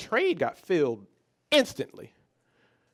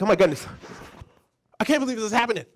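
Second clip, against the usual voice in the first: a louder, higher burst of panic; retold panic; 8 semitones higher, 4 dB louder, much faster than usual. New trader reliving the account crash, loud panicked disbelief. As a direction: blurt it out like you just saw the loss. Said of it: "I'm starting to panic".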